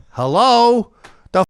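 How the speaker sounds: loud high faux confusion; feigned confusion. Boxer coming out of hiding, loud feigned confusion. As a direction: act lost and loud. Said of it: "you go".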